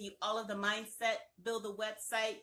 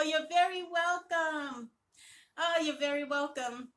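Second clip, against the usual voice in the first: emotional; 6 semitones higher, 5 dB louder than usual. Real, not acted. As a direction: say it with real feeling, voice lifted. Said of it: "I'm gonna get emotional".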